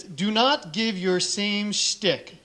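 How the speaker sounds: each word read out very slowly; mock-solemn email read slowly. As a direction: spell out the rule with mock gravity. Reads out the email's instruction.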